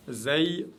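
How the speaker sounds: "Zay" with a clean z; light Arabic zay. Shown as a demonstration. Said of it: "toujours légère".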